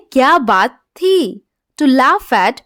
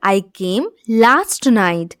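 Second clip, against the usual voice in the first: slow model reading; 5 semitones lower, 3 dB quieter, much slower than usual. Shown as a demonstration. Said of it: "teacher models the English sentence".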